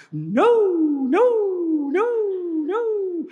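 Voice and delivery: imitating an eerie high tone in the distance; crazy high tone. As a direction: sing it way up like a far-off wail. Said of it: "some crazy tone going".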